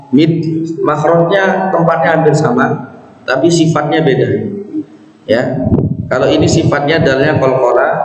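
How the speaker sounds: ordinary talk, nothing marked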